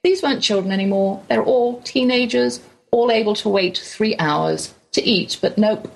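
ordinary talk

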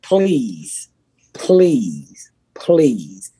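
slow, stretched-out pleas, falling each time; drawn-out pleading. Real, not acted. Host begging listeners to watch on Thursday, drawn-out pleading. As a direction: beg them, dragging out each word.

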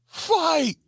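high alarm call; calling out fight. Retold or imitated. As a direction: raise the alarm down the hallway. Somebody at the curtain calls out.

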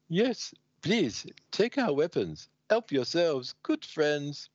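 high, silly put-on voice; compliant stooges. Guards surrendering to the Legionnaires, high silly compliance.

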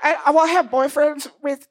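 put-on small Missouri girl, sweetly entitled; Bitsy voice. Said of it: "And she's like".

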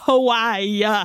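drawn-out imploring, hammed up; mock melodramatic plea. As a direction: stretch it like a soap heroine. Finishes the hammed-up pleading quote.

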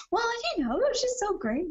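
put-on a cute kid gushing in a high voice; imitating a little kid. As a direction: squeak it out like a tiny, cheerful boy. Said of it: "he's just like".

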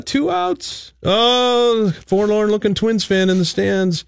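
high, held-out announcer notes; mock play-by-play impression; Royce play-by-play. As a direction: pitch it up high and hold the vowels. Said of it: "doing your best Royce impersonation".

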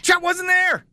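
sudden high, loud cry of disbelief; high loud exasperated exclamation; exasperated outburst. Real, not acted. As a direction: blurt it out in outrage. Exasperated outburst about Chet.

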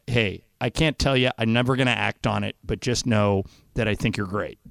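hushed, gentle love confession; soft confession. Retold or imitated. Retelling a tender movie confession.

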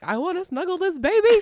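put-on high, loud baby-gushing squeal; gushing excitement. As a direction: squeal it like you can't wait to cuddle. Aunt gushing over new nephew.

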